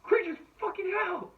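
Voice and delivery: very high-pitched put-on voice; Santa impression. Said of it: "the Sand from Shazam moment".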